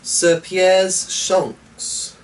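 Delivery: high, very slow grand announcement; exclaiming. A narrator's reading. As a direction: proclaim his name with relish. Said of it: "exclaimed Eleanor".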